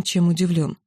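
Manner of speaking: plain reading voice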